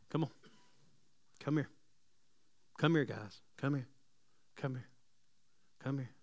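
soft, low come here; gentle call. Acted. Jesus softly calling his disciples to come close. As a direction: beckon them in tenderly. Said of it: "his response was".

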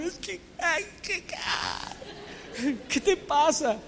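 hammed-up teenage sobbing; mock crying. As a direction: sob it out like a heartbroken teen. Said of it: "llegar a la casa a llorar".

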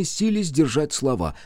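ordinary delivery, nothing marked

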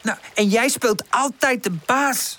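high, slow cross accusation; angry. A narrator's reading. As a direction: throw it at your friend, leaning on the words. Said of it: "zegt Woezel boos".

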